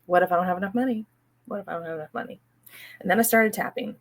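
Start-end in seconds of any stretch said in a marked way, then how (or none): 0.1-1.1 rushed anxious repeat. Shown as a demonstration. Tapping on her money fear, repeating it fast and anxious. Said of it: "So I tapped on". 1.5-2.4 fading murmur. Shown as a demonstration. Tapping on her money fear, the last repeat fading soft. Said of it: "So I tapped on".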